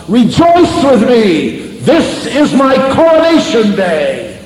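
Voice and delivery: joyful triumphant shout, high and slow; dying father calling out to his family in joyful triumph. Retold or imitated. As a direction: cry it out with the last of his strength. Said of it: "he fairly shouted out".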